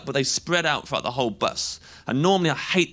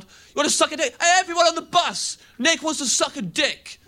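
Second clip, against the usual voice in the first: high, jeering yob call; hammed-up rowdy lad; mock lad shout; 11 semitones higher than usual. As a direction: announce it to the whole bus. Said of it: "Shouting that really loud".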